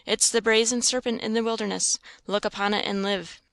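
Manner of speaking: ordinary delivery, nothing marked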